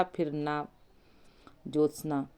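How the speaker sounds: ordinary delivery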